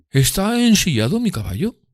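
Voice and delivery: high, lofty gentleman's voice; voicing Drumley as a haughty gentleman. A narrator's reading. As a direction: lord it over the room. Drumley orders waiter.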